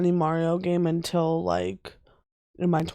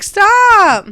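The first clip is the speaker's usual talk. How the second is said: mock shout; 13 semitones higher, 12 dB louder than usual. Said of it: "I was like".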